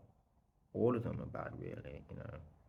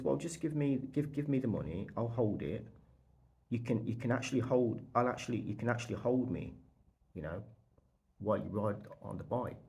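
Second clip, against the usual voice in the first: insistent offer; 3 semitones higher, 5 dB louder than usual. Retold or imitated. Seller offering himself as security.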